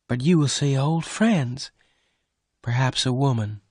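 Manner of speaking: fear losing him to someone else; mild sad lover's worry; a touch of sadness. A narrator's reading. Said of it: "Bess said sadly".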